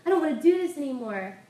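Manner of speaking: a preacher reenacting a desperate plea; crying out to God. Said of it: "she cried out".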